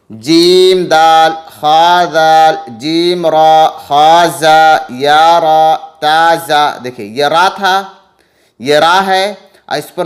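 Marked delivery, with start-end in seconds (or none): 0.1-6.8 each letter name slow and louder; slow letter drill. Shown as a demonstration. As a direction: spell out syllables for children, slowly and clearly. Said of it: "अब देखें".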